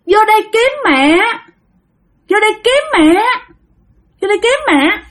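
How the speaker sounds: high, plaintive little spirit voice; child spirit. Acted. Spirit child says it came seeking mother, high voice. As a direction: call for mother as a small ghost.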